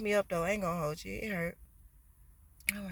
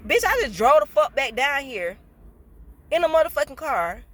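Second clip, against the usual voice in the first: loud, higher, outraged at the drive in pain; furious rant; 5 semitones higher, 7 dB louder than usual. Real, not acted. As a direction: vent the outrage full force.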